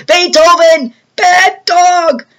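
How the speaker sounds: high, loud scolding yell. Retold or imitated. George yelling at his dog, loud high scolding. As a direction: imitate an angry owner bellowing at the dog. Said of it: "George yells".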